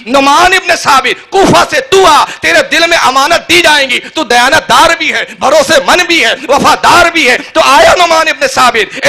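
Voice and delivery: high, loud impassioned sermon; fiery preaching. Acted. Preacher to the gathering, fiery and impassioned. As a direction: thunder it to the crowd.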